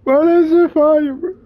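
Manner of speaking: excited. Real, not acted. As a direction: hype it up, laughing. Excited reaction to the AI.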